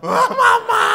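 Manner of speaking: loud, high mock wail; imitated bawling child. Priest mimics retreat children missing their mothers, mock bawling. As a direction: wail for mama like a child, hammed-up. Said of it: "membuat anak-anak retret nangis".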